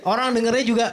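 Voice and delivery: ordinary delivery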